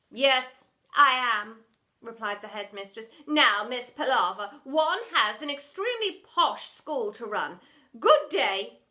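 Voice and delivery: loud, firm dismissal; curt dismissal. Acted. Headmistress dismissing the drama teacher, loud. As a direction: dismiss her curtly.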